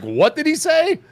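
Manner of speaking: high-pitched, startled mock question; alarmed onlookers. Imagining onlookers if he yells something, mock alarmed.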